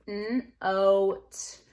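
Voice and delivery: N-oat split, long oh; sounding out long O. Shown as a demonstration. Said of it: "The O goes from ah to O".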